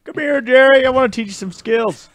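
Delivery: booming high dad voice; loud dad voice. Acted. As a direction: call him over loud and high. Dad character voiced in skit.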